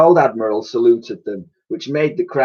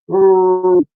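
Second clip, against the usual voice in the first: much higher, louder cooing oh; 6 dB louder than usual. A narrator's reading. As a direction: coo it like a contented mammoth. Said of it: "she cooed, not cried".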